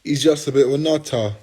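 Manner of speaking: loud mock British accent on "He's just a bit of a nutter"; hammed-up British accent. Shown as a demonstration. Trying on a British accent for the chat, loud and hammed up. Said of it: "I sound so British".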